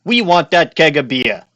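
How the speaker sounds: loud gruff cop bark; 1930s beat cop. Acted. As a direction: yell like an old-time street cop. Reading cop dialogue in voice.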